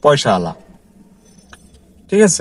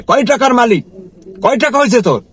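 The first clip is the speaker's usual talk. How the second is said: retold angry boss; 8 semitones higher than usual. Boss mocking his driver about money, high taunting scold. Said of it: "এখন আমাকে এই কথা বলে".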